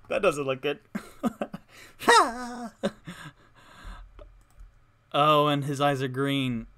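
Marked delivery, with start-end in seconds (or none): 0.0-2.8 laughing. Real, not acted. Startled by something on screen.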